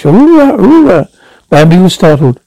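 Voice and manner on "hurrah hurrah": high triumphant screech; loud gung-ho soldier. A narrator's reading. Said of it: "He began to screech".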